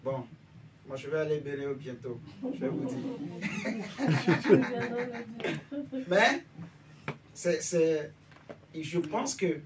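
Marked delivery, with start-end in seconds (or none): 3.0-6.0 laughing. Real, not acted. Laughs at own remark.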